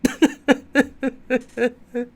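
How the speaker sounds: high, loud staccato fake sobs; hammed-up crying; mock sobbing. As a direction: bawl in short high bursts.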